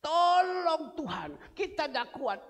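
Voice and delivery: high, loud, slow mock plea of the Israelites; imitated cry for help. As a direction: cry out Tolong Tuhan like a desperate people. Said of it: "Mereka teriak". (meniru jeritan minta tolong, tinggi, keras dan lambat)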